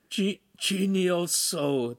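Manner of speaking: slow, high, pained stammer; groaning. A narrator's reading. The Podesta slumps in pain, groaning. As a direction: drag it out in a groan. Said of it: "the Podesta groaned".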